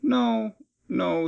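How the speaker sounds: normal talking voice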